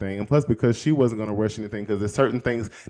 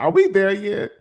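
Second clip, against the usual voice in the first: put-on higher, louder whiny child asking from the back seat; impatient kid; 8 semitones higher, 5 dB louder than usual. Kid in the car pestering parents, higher whiny voice. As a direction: whine it like a restless kid in the car. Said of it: "as a kid or something".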